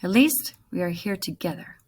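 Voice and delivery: ordinary delivery, nothing marked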